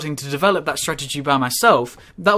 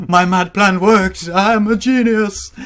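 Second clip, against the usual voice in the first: slow, loud mad scientist gloating; 4 semitones higher, 6 dB louder, much slower than usual. Pretend. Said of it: "that was a mad scientist impression".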